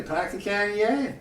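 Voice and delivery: park the car in the yard, Boston style; Boston accent. Acted. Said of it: "the car thing to get the accent right".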